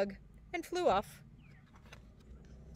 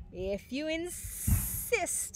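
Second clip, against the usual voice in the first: snake voice; 6 semitones higher, much slower than usual. Acted. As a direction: draw it out like a snake. Snake hisses.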